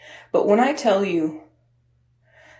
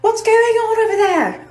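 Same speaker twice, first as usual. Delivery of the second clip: very high-pitched British lady teacher; 13 semitones higher, 4 dB louder than usual. Retold or imitated. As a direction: squawk it in a mock British accent. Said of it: "why I made her British".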